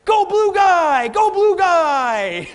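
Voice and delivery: loud, slow, high cheering; kid cheering. Retold or imitated. Young son cheering for Luke in Star Wars, loud slow cheer. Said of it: "he's like".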